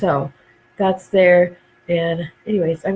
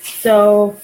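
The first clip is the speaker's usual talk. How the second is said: normal talking voice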